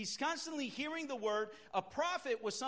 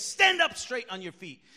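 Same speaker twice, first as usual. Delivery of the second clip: louder, punchier command; shout; 10 dB louder than usual. Retold or imitated. Preacher replaying Paul's shout to the crippled man, loud. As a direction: bark it straight at him. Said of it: "he shouts to him and says".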